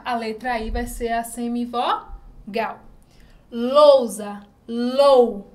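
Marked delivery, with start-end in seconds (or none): 3.5-5.5 Lousa then lou, said loud and held; stressed syllable, louder. Shown as a demonstration. Teacher sounding out lou for pupils, loud. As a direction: sound out lou loudly for the class. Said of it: "Qual é a vogal mais forte?".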